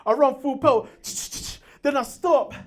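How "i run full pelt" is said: ordinary talk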